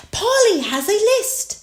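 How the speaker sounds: very high, proud little piglet. A narrator's reading. Peppa presents her parrot to Miss Rabbit, proudly. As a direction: announce it proudly in a child's pitch. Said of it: "Peppa said proudly".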